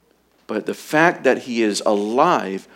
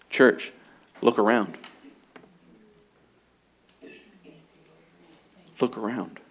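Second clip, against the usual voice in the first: much softer, almost hushed; hushed appeal; 8 dB quieter than usual. Acted. Preacher quietly urging the church to look around, hushed. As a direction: pull the church in close and quiet.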